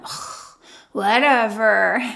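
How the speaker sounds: a breathy ugh, then a slow, drawn-out whatever; eye-rolling whatever. Retold or imitated. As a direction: roll your eyes and drag it out, teen style. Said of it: "you'll get some eye roll".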